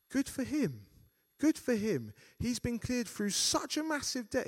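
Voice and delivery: high, bright cheer for him. Retold or imitated.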